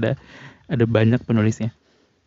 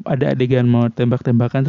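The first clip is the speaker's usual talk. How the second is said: ordinary talk, nothing marked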